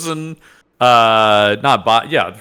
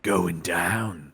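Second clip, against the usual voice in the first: cool one-liner; 8 semitones lower, 10 dB quieter than usual. Pretend. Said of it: "Boba Fett's classic one-liners".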